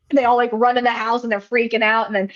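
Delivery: usual speaking voice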